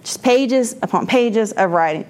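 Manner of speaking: ordinary talk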